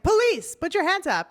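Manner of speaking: barked cop command, loud and high; mock police shout. Cop yelling at stuck protesters, mock shout. Cops arriving at glued protesters.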